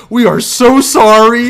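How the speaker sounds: high, loud, drawn-out apology; mock apology. Her friends apologizing for her, loud high mock apology. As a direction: imitate gushing apologetic friends at full volume.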